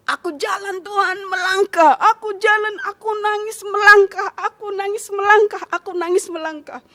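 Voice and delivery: tinggi, keras, meratap penuh haru; seruan sambil menangis. Retold or imitated. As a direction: ulangi tiap seruan seperti menangis sambil melangkah. Menghidupkan langkah pertamanya sambil menangis. (berseru dengan tangis haru)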